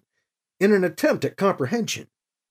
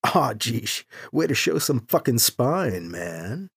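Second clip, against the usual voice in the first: low, soft muttered aside; quiet inner thought; 6 semitones lower, 6 dB quieter, slower than usual. A narrator's reading. Priest to himself about the pilot's lack of spine, muttered. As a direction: grumble it privately under your breath.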